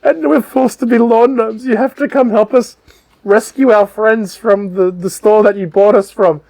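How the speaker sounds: high-pitched, hammed-up sob story; pleading gnomes. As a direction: beg for rescue as a hard-done-by little gnome. Gnomes beg for rescue, acted.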